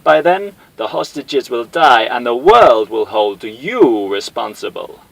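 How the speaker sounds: slow, loud, high menacing villain; villain ultimatum. Acted. Bison issuing a ransom ultimatum to Guile, slow menacing villain. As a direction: deliver the ultimatum with relish.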